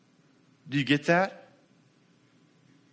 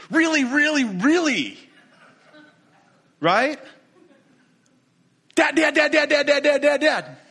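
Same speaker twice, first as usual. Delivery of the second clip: put-on high, loud pleading child voice; kid pestering dad; 10 semitones higher, 7 dB louder than usual. Child pestering his dad, high and loud. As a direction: whine it like an eager kid begging dad for attention. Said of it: "do your kids do this?".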